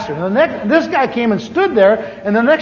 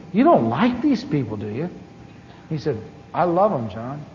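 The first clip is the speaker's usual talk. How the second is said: tender hush; 6 semitones lower, 8 dB quieter than usual. Retold or imitated. Tender quiet exchange.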